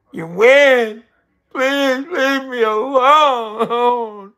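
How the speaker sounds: high, loud, slow drawn-out begging; hammed-up whimpering surrender; whiny mock plea. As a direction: play the pathetic loser begging to be left alone. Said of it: "I'll be like".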